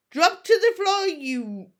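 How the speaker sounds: very loud, slow commanding bellow; yelling. A narrator's reading. Chief yelling the dragon into submission. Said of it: "yelled Stoic".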